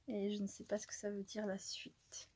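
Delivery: a puzzled aside in a whisper. Real, not acted. Puzzling over the card's words in a whisper. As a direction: murmur it to yourself without voice.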